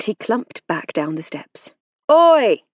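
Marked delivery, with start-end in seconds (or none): 2.1-2.6 loud, high call across the field; yell. A narrator's reading. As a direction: shout to get his mate's attention. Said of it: "he yelled".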